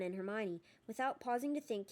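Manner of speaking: usual speaking voice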